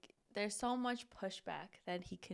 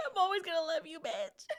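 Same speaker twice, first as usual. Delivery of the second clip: high-pitched, tearful fake declaration; hammed-up sobbing love vow; mock crying; 8 semitones higher, faster than usual. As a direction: wail it like a comic weeper. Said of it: "He starts crying".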